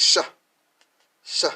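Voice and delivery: "sha" with SH as in she; teacher demonstrating the syllable "sha"; SH sound. Shown as a demonstration. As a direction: sound out "sha", short and loud. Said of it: "It makes a S H sound as in she".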